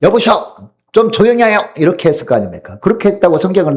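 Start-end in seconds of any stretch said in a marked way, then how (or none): 0.0-1.6 mildly acted shushing, pitched higher; scolding bystander. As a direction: play an annoyed onlooker hushing someone. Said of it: "이 모습을 본 사람들은 어떻게 합니까". (가볍게 나무라는 구경꾼 흉내)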